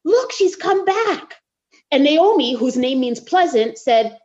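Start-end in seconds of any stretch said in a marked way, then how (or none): 0.1-1.4 higher, excited voices of the crowd; excited crowd spotting Naomi's return; excited townsfolk. Retold or imitated. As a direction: gasp it out like surprised neighbours. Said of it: "everyone was so excited and said".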